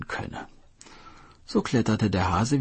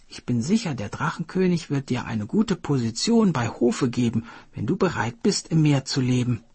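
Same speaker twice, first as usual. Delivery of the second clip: friendly coaxing turtle, pitched higher; turtle character voice; 7 semitones higher, 3 dB louder than usual. Acted. Turtle luring the hare to the sea palace, coaxing. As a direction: sweet-talk the hare into coming along.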